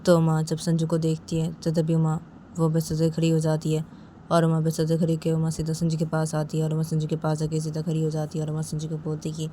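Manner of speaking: usual speaking voice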